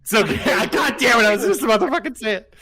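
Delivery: loud, fast laughing outburst; laughing shout. Real, not acted. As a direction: blurt it out while laughing.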